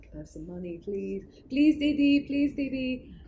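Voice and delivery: mock street beggar begging a big sister; imitating street beggars. Beggars at the cab window.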